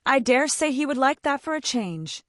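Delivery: cheeky and unashamed; brazen. A narrator's reading. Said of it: "said Valancy brazenly".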